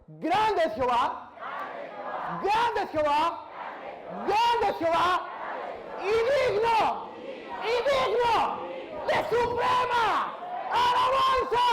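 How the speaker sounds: slow, loud, rising praise cry; calls the church to praise at full voice; shouted praise. Shown as a demonstration.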